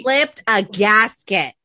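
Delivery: slowly, punching flipped; slow emphatic repeat. Real, not acted. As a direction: drag out flipped for effect.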